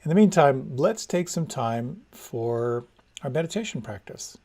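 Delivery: normal talking voice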